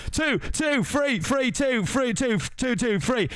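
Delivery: high, quick calls of the guards; guard voices. Acted. Guards shouting picks at Lord Farquaad, high voices. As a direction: toss out numbers like eager guards. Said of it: "I'm developing voices as we go".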